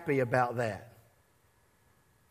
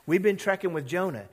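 usual speaking voice

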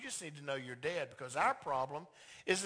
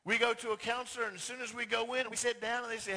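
ordinary talk, nothing marked

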